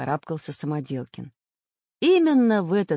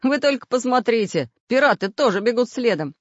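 lightly amused, higher and louder; amused scoff; 7 semitones higher, 6 dB louder than usual. A narrator's reading. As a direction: point it out with a touch of a chuckle. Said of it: "усмехнулся Самоделкин".

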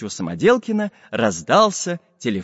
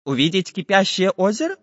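surprised; 6 semitones higher than usual. Acted. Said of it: "удивился Самоделкин".

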